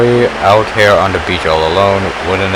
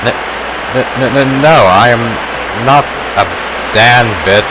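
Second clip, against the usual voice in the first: flustered, tripping over the first word; stammering denial; 2 semitones higher than usual. Acted. To a boy who calls her a bandit, stammering denial. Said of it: "I stammered".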